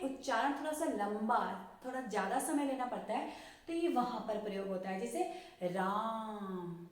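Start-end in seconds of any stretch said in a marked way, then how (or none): 5.6-6.9 राम with the आ stretched very long; drawn-out vowel. Shown as a demonstration. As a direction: hold the आ in राम like a pluta vowel. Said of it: "इस तरह से लंबा उच्चारण". (आ को बहुत लंबा खींचकर राम)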